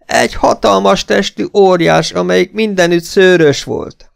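high, wailing description; hysterical wailing. A narrator's reading. Esmeralda describing the abductor, high and hysterical. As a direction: describe the monster in a high lament.

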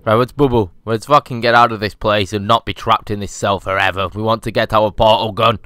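ordinary delivery

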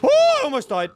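high, loud gasp of relief; breathless relief. Real, not acted. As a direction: shaken after near death. Narrow escape.

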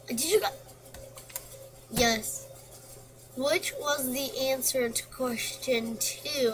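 usual speaking voice

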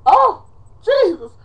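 high, loud melodramatic cry; over-the-top exasperated wail; dramatics. Pretend. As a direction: cry out in hammed-up anguish. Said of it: "enough of my dramatics".

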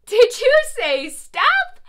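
high, loud, slow mock astonishment; teacher hammed-up surprised to the class, loud and high; mock surprise. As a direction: pretend you just heard them shout. Teacher pretends to hear kids.

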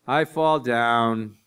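put-on slow, drawn-out little-kid wail; toddler voice. Toddler calling to dad over the monitor, slow drawn-out wail. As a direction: stretch it out like a toddler stalling bedtime.

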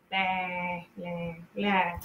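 a hint of dull boredom; bored blah blah. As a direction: drone it like nothing matters. Bored scrolling on couch.

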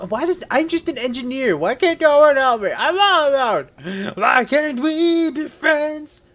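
high, drawn-out whiny wail; hammed-up lonely engineer crying out; pitiful mock wail, high and slow at the end; mock lament. Mock self-pity while playing.

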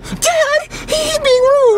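crying whine. Acted. Junior whining to dad.

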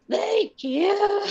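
high crying thank you; mother thanking the pilot, crying; tearful thanks. Retold or imitated. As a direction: thank him through tears. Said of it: "I was like".

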